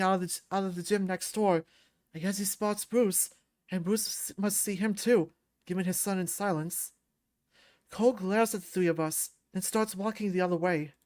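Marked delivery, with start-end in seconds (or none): none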